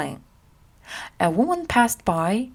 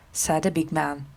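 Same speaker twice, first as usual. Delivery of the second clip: ordinary delivery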